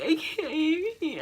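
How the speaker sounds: high, slow mock sobbing; tearful refusal. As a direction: whine it as if crying too hard to manage. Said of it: "I'm crying and crying and crying".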